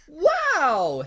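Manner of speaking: high, loud, drawn-out amazement; shout of awe. A narrator's reading. Boy amazed by magic, awed shout. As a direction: shout it wide-eyed, hammed-up. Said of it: "Harry shouted in awe".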